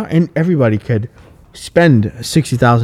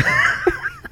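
high-pitched laugh; 4 dB quieter than usual. Real, not acted. Laughing at his own story.

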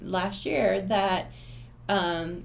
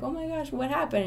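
put-on surprised girl; 6 semitones higher, faster than usual. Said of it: "She was like".

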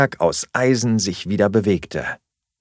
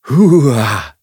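loud, higher play-scream; wilder Kampfschrei; 4 semitones higher, 8 dB louder than usual. A narrator's reading. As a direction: yell it like an attacking child. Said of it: "schrie eine der beiden". (lauter, gespielter Kinderschrei)

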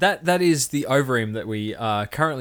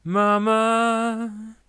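mock mama voice; 10 semitones higher, much slower than usual. Mock motherly voice, high and slow.

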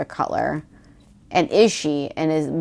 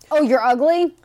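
mock surprise; 6 semitones higher than usual. Mock reaction quoted.

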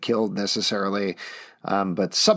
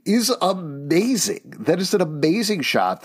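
excited gush; 8 semitones higher than usual. Real, not acted. Gushing over trailer shot.

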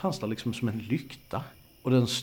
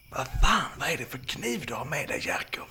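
alarmed hissing whisper; hissed; 4 semitones higher than usual. Acted. Sara to her friend about his knife, hissed. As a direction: hiss it at him under the breath. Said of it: "väser Sara".

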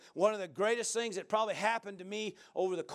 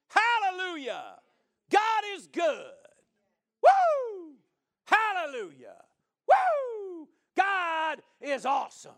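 loud, high whoops and hallelujahs; exultant preacher raising the voice in praise; shouted praise; 7 semitones higher, 5 dB louder than usual. Shown as a demonstration. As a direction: shout it out for joy, pitch leaping up. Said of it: "Why are you talking so loud".

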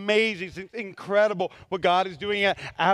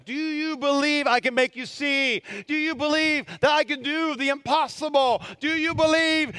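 higher, measured, insistent questioning; preached challenge; 7 semitones higher than usual. Retold or imitated. Jesus asking blind men about their faith, forceful acted challenge.

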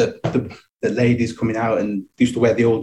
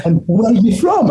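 high, slightly louder mock old-lady question; curious old lady; 10 semitones higher, 4 dB louder than usual. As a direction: ask it like a nosy old lady. Imitating old ladies questioning him.

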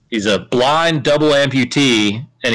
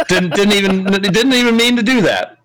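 laughing whine; 7 semitones higher than usual. Real, not acted. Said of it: "accidentally copied and pasted".

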